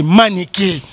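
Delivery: normal talking voice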